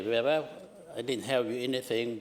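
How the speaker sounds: ordinary talk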